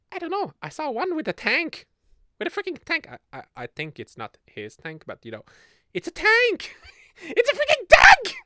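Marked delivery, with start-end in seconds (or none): 0.1-3.1 much higher, louder in disbelief; can't believe the tank; squeaky shocked exclaim. Real, not acted. Shocked about furry's tank. 5.9-8.4 very high, loud squeal over the tank; high shocked shriek; shrieking disbelief. Real, not acted.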